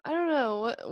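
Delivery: normal talking voice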